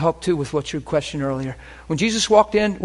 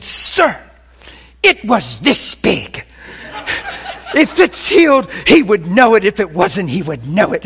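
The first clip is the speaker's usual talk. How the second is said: imitating an overwrought wife, loud and higher; emotional outburst; 6 semitones higher, 7 dB louder, slower than usual. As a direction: insist emphatically, voice jumping up. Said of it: "she was just so emotional".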